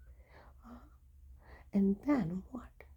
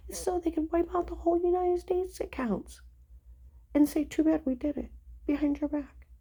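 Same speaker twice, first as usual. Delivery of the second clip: higher and louder, heated outrage; real angry outburst; indignant rant; 8 semitones higher, 5 dB louder than usual. Real, not acted. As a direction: rail against the lie, voice rising. Ranting about stolen money.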